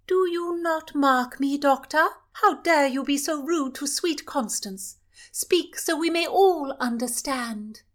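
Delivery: high, loud and bored royal demand; the princess scolding the doctor, high and loud. Acted. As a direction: cut him off like a spoiled royal.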